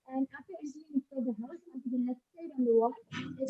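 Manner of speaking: muffled and faint, as if covered. Real, not acted. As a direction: speak through a hand over your mouth. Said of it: "your audio is getting muffled".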